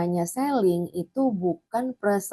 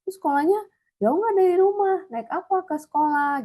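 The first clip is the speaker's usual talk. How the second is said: much higher, louder bright interest; eager curious asking; 11 semitones higher, 3 dB louder than usual. Acted. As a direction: pile on the questions with eager curiosity. Acted chatty questions to client.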